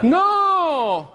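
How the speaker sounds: high, drawn-out comic "no"; mock outraged no. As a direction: cry it out in mock protest. Mock denial to teased audience.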